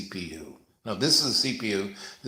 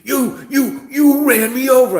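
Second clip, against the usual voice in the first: very high, very loud stammer; hammed-up hysterical woman; hysterical stammering shriek; 12 dB louder, slower than usual. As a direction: screech the accusation. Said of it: "she goes".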